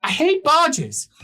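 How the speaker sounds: high, loud grumpy bargeman; Lucas voice. Acted. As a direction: whine it out as a put-upon captain. Lucas grumbles.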